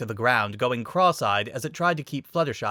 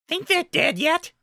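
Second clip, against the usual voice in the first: high, silly henchman; dim henchman voice. A narrator's reading. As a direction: ask like a dim goon. Diamond Dog henchman speaks.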